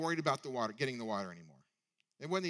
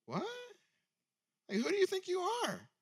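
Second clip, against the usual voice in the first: high, incredulous and defiant; indignant challenge. Retold or imitated. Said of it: "Basically, she says".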